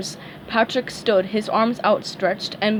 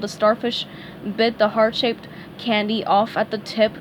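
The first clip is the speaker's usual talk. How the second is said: ordinary delivery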